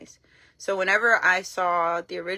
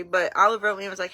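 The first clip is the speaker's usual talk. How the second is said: ordinary talk, nothing marked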